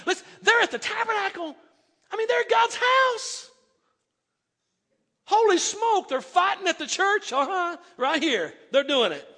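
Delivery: high, loud outcry of amazement; excited exclamation. Real, not acted. Preacher to congregation, high excited outcry. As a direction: cry out in disbelief at the scandal.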